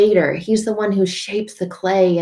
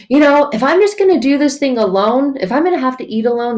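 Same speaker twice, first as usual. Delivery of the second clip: higher, louder stepdad imitation; mock angry rant; 6 semitones higher, 5 dB louder than usual. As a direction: grumble loudly as the fed-up stepdad. Stepdad's rant quoted again.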